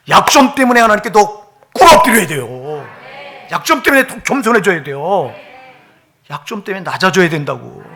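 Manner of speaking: loud, high, forceful sermon exhortation; impassioned preaching. Real, not acted. Preacher urging the congregation to bow before God, impassioned.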